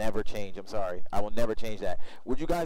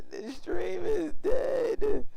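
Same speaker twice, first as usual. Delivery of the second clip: dismayed wail; much slower than usual. Real, not acted. Stream crashes mid approach.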